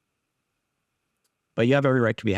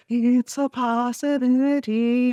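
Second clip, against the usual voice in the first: high, slow, hammed-up weepy line; mock crying; 13 semitones higher, slower than usual. Bella at the window, mock tearful. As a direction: whimper it like a parody of heartbreak. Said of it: "fucking crying to".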